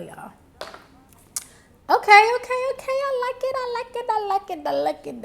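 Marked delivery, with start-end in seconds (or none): 1.9-5.2 excited. Real, not acted. Excited at her own singing.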